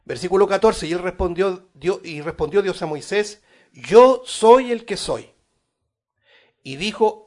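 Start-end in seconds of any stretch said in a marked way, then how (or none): 3.7-5.3 slow, loud, high proclamation; proclaimed slowly. Acted. Preacher booming God's reply to Moses, slow and loud. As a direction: ring out each word of the divine name.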